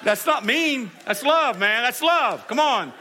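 loud, high, laughing preacher; excited preaching. Real, not acted.

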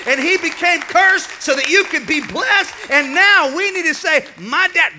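loud, high, driving sermon delivery; full force preaching. Real, not acted. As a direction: preach it to the rafters.

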